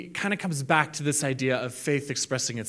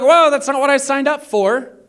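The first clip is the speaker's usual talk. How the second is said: high, loud whoa; shocked protest; 10 semitones higher, 8 dB louder than usual. Retold or imitated. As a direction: balk at the deal. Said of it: "we would all be like".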